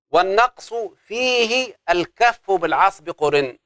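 slow chanted teaching verse; slow verse recitation. Shown as a demonstration. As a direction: sing the line out for memorizing. Recites verse again slowly.

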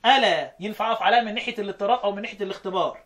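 ordinary talk, nothing marked

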